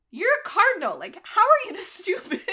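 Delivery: loud, high-pitched mock outrage; pissed off. Furious king berating his cardinal, mock angry. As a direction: act out an incredulous, angry telling-off, breaking into a laugh. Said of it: "so pissed 'cause he's like".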